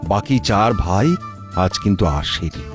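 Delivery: usual speaking voice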